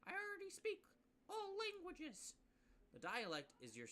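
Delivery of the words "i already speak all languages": much higher, put-on puzzled voice; confused player. Player baffled by the rules, high confused squeak. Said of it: "you're probably sitting over here like".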